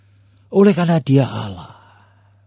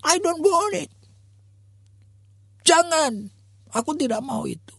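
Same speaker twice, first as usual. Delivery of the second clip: put-on high, pleading child voice; frightened little girl. Daughter begging her father not to operate, high pleading child. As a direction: beg like a small child afraid of surgery. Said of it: "anaknya mengatakan".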